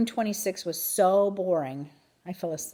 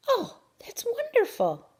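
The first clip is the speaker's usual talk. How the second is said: awed. A narrator's reading. Mrs Brisbane marveling.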